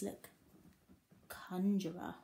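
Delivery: ordinary talk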